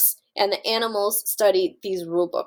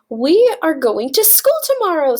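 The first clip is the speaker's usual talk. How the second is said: higher, louder farmer's call; excited announcement; 5 semitones higher, 6 dB louder than usual. Acted. Farmer telling Duck about school, excited call. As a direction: deliver the big news brightly.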